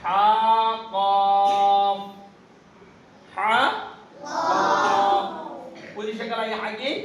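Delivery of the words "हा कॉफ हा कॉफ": each letter name sung out long and loud, कॉफ stretched very slowly; drawn-out letter names. Shown as a demonstration. Teacher sings हा and कॉफ to the class, very slowly. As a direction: chant हा and कॉफ slowly for the pupils to copy. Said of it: "जैसा कि सुनो".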